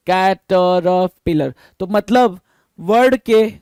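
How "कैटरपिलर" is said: कैटरपिलर slowly broken into separate syllables. Shown as a demonstration. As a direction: sound out कैटरपिलर piece by piece, drawing each out. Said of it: "word को आप टुकड़ों में बांट दे रहे हो". (कैटरपिलर को धीरे-धीरे, टुकड़ों में बांटकर)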